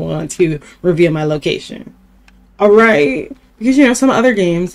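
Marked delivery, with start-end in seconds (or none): 2.6-4.7 excited. Real, not acted. Excited defensive explaining.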